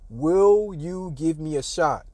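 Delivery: slow, deliberate, drawn-out plea; insistent slow repeat. Retold or imitated. Walk-on pressing the coach for tryouts, slow insistence. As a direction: spell it out word by word to press the point.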